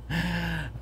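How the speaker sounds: short, high, breathy laugh at his own bad luck; rueful laugh. Real, not acted. Coder laughs as Unity freezes his project, a rueful laugh. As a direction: laugh it off so you don't cry. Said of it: "I'm laughing because otherwise I would be crying".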